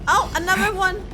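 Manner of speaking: normal talking voice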